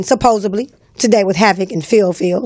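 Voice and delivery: normal talking voice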